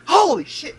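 high loud burst of shock; reliving the reveal, a high excited shout; excited exclamation. Retold or imitated. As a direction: blurt it out in disbelief. Recalling the Red Skull reveal.

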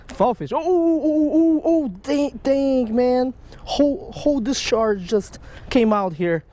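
usual speaking voice

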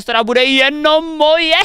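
high, slow, savouring "jenom moje"; smug, stretched-out boast; gloating. Acted. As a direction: lord it over the others, drawn out. Gloating over own game room.

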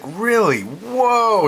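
high, amazed whoa; a burst of amazement; surprised whoa. Retold or imitated. Retelling the postmaster's news, a high amazed whoa.

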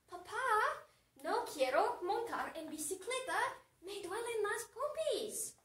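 put-on pleading teen whine, rising high at the end; acted griping with swooping pitch; whining protest. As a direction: play a daughter whining to a parent. Daughter complains again to dad.

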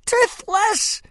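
very high, loud mocking cry. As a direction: shout it out in glee. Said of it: "cried Snotlout".

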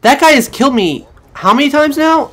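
loud, high, indignant venting at the enemy; angry rant. Real, not acted. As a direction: rage at the guy who keeps killing you. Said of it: "I sound angry about it".

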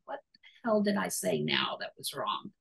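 hushed anxious whisper to herself; soft whispered inner worry; whispered worried thought. Retold or imitated. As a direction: whisper it like a private panic. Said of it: "And I'm thinking".

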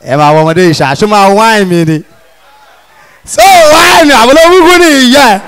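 angry street insult. Acted. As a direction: hurl insults at the girl who ignored you. Yoruba boy insults the girl.

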